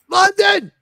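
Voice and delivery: very high, loud exclamation; mock outcry. Host riffing on a Super Bowl in London, mock outcry. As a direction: cry it out in mock outrage. Mock disbelief at his own line.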